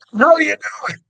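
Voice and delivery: high, loud shout; startled yell. Retold or imitated. Bursts out of leaves at Sasquatch.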